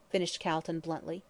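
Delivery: usual speaking voice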